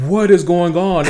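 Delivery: put-on scared kid. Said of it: "He's like".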